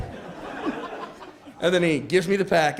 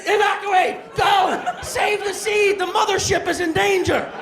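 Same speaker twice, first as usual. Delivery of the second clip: high-pitched emergency orders; urgent alarm shout; 10 semitones higher than usual. Acted. Brain orders the testicles to evacuate, urgent alarm shouting.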